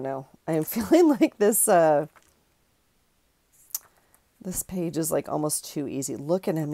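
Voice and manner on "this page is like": ordinary delivery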